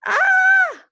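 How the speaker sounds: piercing high scream; mother screaming; terrified scream. A narrator's reading. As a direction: scream in terror.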